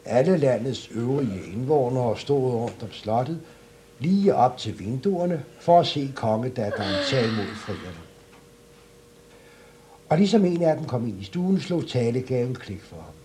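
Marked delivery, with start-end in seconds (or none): none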